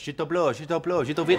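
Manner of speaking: gibberish French, lightly voiced; fake French. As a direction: toss off nonsense French. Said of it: "I only know fake French".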